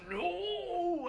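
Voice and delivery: long, high-pitched drawn-out "no"; in-character cry of protest; yelled refusal. Acted. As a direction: yell it out high and stretch the vowel. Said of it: "like, yells out".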